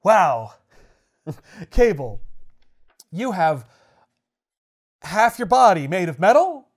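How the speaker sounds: loud, slow sneer; sneering panto villain. Retold or imitated. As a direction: taunt him like a smug villain. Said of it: "Magneto's like".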